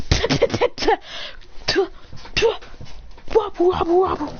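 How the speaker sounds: high puffed "pah" hits, then wobbling; imitated noises. Storyteller sounding out the scuffle, puffy cartoon sound effects. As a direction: pop out puffs, end on a high wobble.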